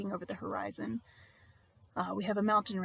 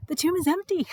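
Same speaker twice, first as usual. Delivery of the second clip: higher, louder cry of alarm; anguished cry; 9 semitones higher, 7 dB louder than usual. Acted. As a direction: cry it out in distress, voice raised. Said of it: "She cries".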